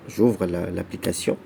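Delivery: ordinary talk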